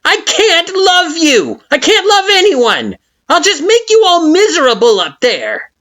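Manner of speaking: very high, loud, furious flower shout; scornful Flowey. A narrator's reading. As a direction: spit the words out in contempt. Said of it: "from smug to scornful".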